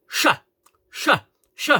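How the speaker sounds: the "sh" ending of pressure punched out alone; missing syllable. Shown as a demonstration. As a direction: drill "sh" as separate, emphatic bursts. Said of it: "to finish the fucking word".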